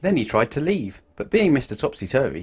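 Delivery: ordinary delivery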